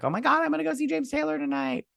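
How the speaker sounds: imitating a thrilled fan before a concert; mock fangirl. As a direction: rush it out like a giddy superfan. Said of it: "You know, I'm not like".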